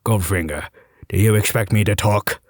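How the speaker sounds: low Scottish Bond voice; Sean Connery impression. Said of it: "in my best Connery".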